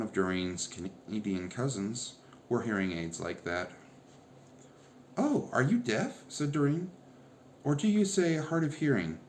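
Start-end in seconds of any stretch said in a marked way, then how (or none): 5.2-9.2 eager high question. A narrator's reading. Doreen asks eagerly.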